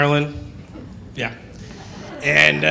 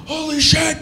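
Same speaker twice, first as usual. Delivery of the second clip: shocked father; 9 semitones higher than usual. Retold or imitated. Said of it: "he goes".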